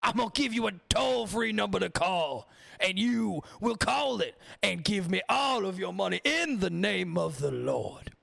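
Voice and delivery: a mega-church faith healer milking the congregation; televangelist impression. Streamer mocking a televangelist to his chat, as a hammed-up high preacher. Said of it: "do my voice impressions like that".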